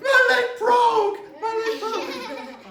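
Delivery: very high and loud, stretched out, laughing through it; high, slow wailing shriek. Retold or imitated. Girl who crashed into the tree, wailing about her leg. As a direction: wail it like a hysterical kid. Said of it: "she's like".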